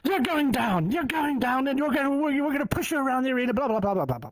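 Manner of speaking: high-pitched mock tough-kid taunt; angry bravado. Twelve-year-old boy threatens rival builder with angry bravado. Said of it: "this anger".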